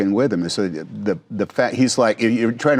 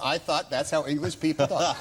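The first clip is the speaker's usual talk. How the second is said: usual speaking voice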